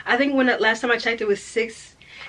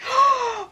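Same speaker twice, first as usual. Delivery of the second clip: sudden high gasp of shock; sharp gasp. Real, not acted. Gasps on seeing a spoiler, sharp gasp. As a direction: catch your breath at a surprise.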